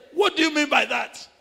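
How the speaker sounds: loud, high, sharp question; indignant demand. Retold or imitated. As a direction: demand an answer in irritation. Angry brother demands explanation.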